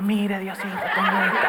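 amused mock abuelita; laughing granny imitation. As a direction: play the shy granny while chuckling. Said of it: "como mi abuelita".

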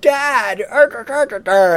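hammed-up whiny daughter calling out; mock daughter. Mocking daughter's whiny cry.

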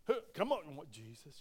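normal talking voice

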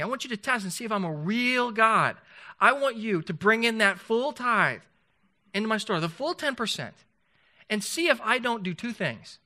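high, loud fervent preaching; full force preaching. Acted. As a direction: thunder it out as God's dare. God's challenge retold at full force.

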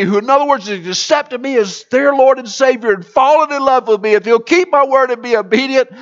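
high, loud preacher's cadence; fervent sermon delivery; full-force preaching. Acted. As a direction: preach it out to the whole room. Pastor repeats the rhetorical question.

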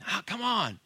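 high, loud, wheedling tempter; tempting serpent. Retold or imitated. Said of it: "Guess what the serpent was saying?".

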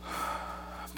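long, heavy, breathy sigh; a big sigh of weariness at the endless sameness. Acted. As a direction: let out a big weary sigh. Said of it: "Big sigh".

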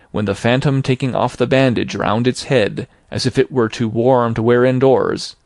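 ordinary delivery, nothing marked

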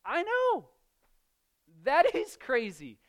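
I know leaps high, then a loud That is crazy; Jesus agreeing loudly that it is crazy; emphatic agreement. Retold or imitated. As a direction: exclaim in full agreement. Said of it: "And Jesus says".

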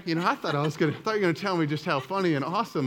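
ordinary talk, nothing marked

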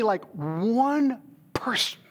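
breathy whispered plea, slow; whispered prayer. Retold or imitated. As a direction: whisper it like a private prayer to God. Retold prayer to God.

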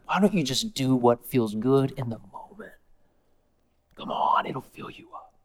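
soft, low coaxing whisper; whispering tempter. Acted. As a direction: lure them in quietly.

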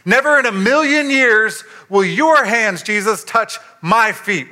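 loud, high, insistent protest; emphatic refusal. Retold or imitated. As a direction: refuse him outright, NEVER hammered. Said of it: "Peter can't control himself".

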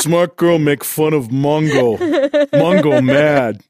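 slow, caveman grunt speech; dumb brute voice. Pretend. Mocking the bully as a slow caveman. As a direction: talk like a dim-witted brute.

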